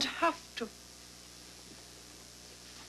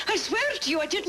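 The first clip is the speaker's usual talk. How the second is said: desperate plea; 7 semitones higher, faster than usual. Acted. Accused woman protests innocence.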